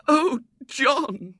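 high, loud wail. A narrator's reading. Wife to husband, high loud wail. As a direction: let his name break into a wail. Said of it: "wailed Missus Brown".

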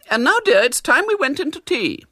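high, prim lady's voice; a polite spinster forcing kindness. A narrator's reading. Miss Milton to the disguised boy, high and prim.

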